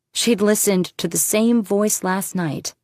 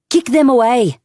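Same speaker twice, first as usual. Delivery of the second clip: higher, loud barked command; order; 7 semitones higher, 9 dB louder than usual. A narrator's reading. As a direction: snap the order at them. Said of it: "Irene ordered".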